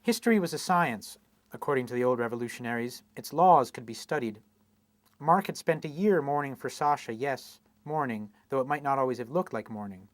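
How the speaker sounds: a steady, even reading voice for a literary novel; literary reading with dry, understated irony; story narration. A narrator's reading. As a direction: read it like an author at a public reading, measured and unhurried. Reads novel excerpt aloud.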